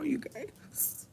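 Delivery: barely voiced, drained; weak whisper. Real, not acted. Tearful vlogger telling viewers she feels weak, faint whisper. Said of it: "I feel weak right now".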